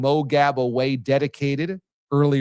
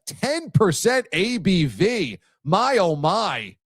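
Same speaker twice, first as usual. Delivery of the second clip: impressed; 8 semitones higher, much slower than usual. Real, not acted. Reacting to strong beer.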